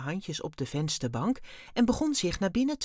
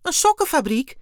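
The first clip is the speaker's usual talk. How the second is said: very high, loud astonished exclamation; bewildered cry; 11 semitones higher, 11 dB louder than usual. A narrator's reading. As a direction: cry out, utterly flabbergasted. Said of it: "riep Dexter verbijsterd uit". (verbijsterd uitroepen)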